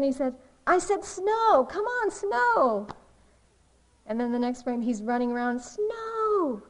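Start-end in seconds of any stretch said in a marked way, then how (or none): 0.7-2.9 high, loud mock demand; Calvin demanding. Cartoon kid ordering the heavens to snow, insistent mock yell. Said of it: "then he looks up again". 5.6-6.6 high, drawn-out mock wail; Calvin worked up. Cartoon kid running around wanting snow, worked-up mock cry. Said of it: "he's getting really worked up".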